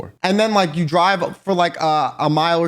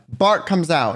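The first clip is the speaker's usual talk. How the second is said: normal talking voice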